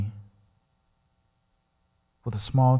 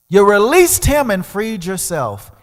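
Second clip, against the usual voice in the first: emphatic declaration; 9 semitones higher, 10 dB louder than usual. Retold or imitated. Voice declaring release.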